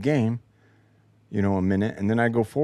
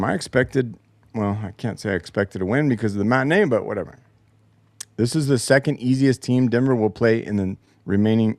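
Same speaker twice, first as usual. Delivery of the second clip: ordinary talk, nothing marked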